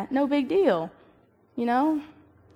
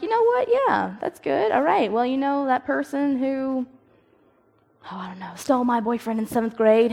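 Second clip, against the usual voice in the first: mock breezy forgiveness; 4 semitones higher than usual. Mocking someone who pretends to forgive, sing-song. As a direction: play it syrupy and over-sweet, dragging the words. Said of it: "you can go home tonight and be like".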